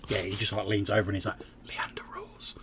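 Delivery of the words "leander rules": whisper. Acted. GM voicing NPC leaning over.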